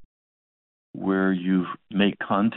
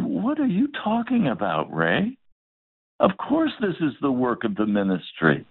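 mock frustrated, high on the opening question; an exasperated senior pastor, lightly played; frustrated retort; 5 semitones higher than usual. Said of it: "looked at me in absolute frustration".

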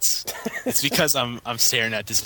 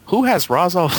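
after laughing hard; 7 semitones higher, 5 dB louder than usual. Real, not acted. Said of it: "I can't even say this sentence".

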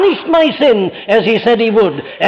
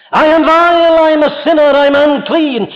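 higher, louder cry of guilt; pleading sinner's outcry; anguished confession; 8 semitones higher, 4 dB louder than usual. Acted. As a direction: cry out your sinfulness. Preacher voicing a sinner's confession.

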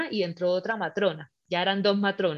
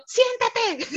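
much higher, acted yell to sit; retold exasperated order; 13 semitones higher, 3 dB louder than usual. Labouring woman orders hovering partner to sit, acted exasperated yell. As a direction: act out losing patience.

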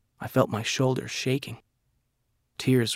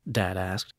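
plain reading voice

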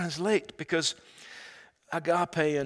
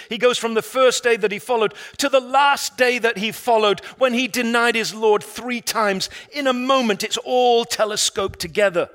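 loud, high, driving sermon climax; full-force preaching; 6 semitones higher, 11 dB louder than usual. Acted. Preacher urging the congregation toward breakthrough, loud and high. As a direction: preach it hard and build.

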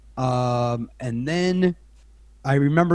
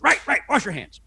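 caught out; 8 semitones higher than usual. Retold or imitated. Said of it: "Ted Danson goes".

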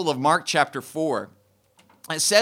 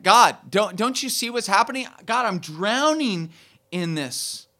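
desperate plea; 5 semitones higher, 4 dB louder than usual. Retold or imitated. Voicing a desperate prayer.

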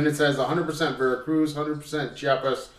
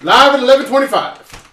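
announcer voice; 11 semitones higher, 13 dB louder than usual. Acted. Announcing the live show start.

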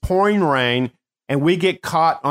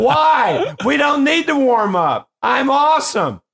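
cocky gleeful shout; 11 semitones higher than usual. Retold or imitated. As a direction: crow it out like you're unbeatable. Player bragging, refusing warm-up.

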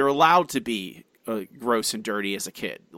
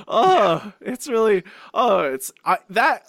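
higher, louder, recoiling; disgusted; 6 semitones higher, 4 dB louder than usual. Real, not acted. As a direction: squirm in disgust. Recoiling at grease idea.

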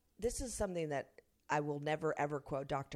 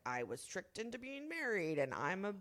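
whiny ex impression; 4 dB louder than usual. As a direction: play the poor victim. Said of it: "Woe is me, get out my violin".

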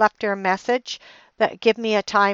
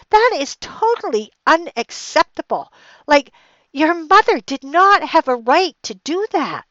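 raised, higher-pitched retelling of her angry words; retold indignant outburst; 6 semitones higher, 3 dB louder than usual. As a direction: replay the outrage at the mother-in-law, voice pushed up. Retold phone row over sold antiques.

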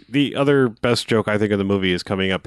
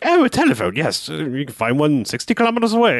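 much higher mock British reply; British accent; 5 semitones higher than usual. Bartender answering the tourists in a high mock British accent. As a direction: sing it out like a prim British barman. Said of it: "most perfect British accent".

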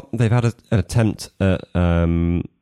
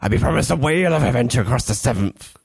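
put-on old sailor; 3 semitones higher than usual. Pirate voice reading.

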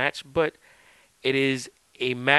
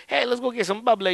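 higher mock Southern accent, said lightly; 11 semitones higher than usual. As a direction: put on a playful Southern accent. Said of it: "you do the Southern accent".

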